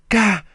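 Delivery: sharp high cry of shock; startled gasp. Acted. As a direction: yelp as if shot at. Shot fired at her.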